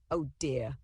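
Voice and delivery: plain reading voice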